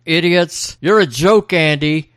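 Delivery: high-pitched mock yelling; mock jeering shout; retold yell. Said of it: "yells at them".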